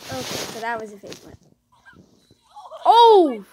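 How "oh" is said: loud. Real, not acted. Reacts to painful hit.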